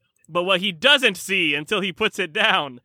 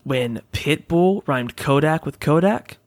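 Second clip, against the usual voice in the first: ad read; 3 semitones lower than usual. Acted. Reads a sponsor ad.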